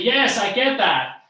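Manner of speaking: booming, drawn-out reply; match a very loud talker; really loud. Shown as a demonstration. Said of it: "talks really loud".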